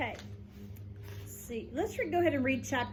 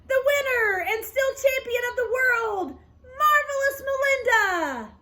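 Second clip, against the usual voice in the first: loud, high ring-announcer bellow; 10 semitones higher, 6 dB louder than usual. A narrator's reading. Lori bellows the winner like a ring announcer. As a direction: announce the champion to the crowd. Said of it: "she bellowed".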